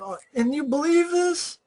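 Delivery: can't believe they buy it; high pitched ranting at the screen; exasperated disbelief. Retold or imitated. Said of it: "I'm like".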